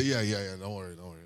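low, soft, dismissive imitation of a calm pastor; unbothered reassurance. Experienced pastor answering an anxious father, unbothered reassurance. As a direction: brush off the worry in a sleepy low murmur. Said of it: "That's experience talking".

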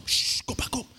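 whispered urging; softly shooing a dog. Retold or imitated. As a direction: hush the dog away. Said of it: "She said".

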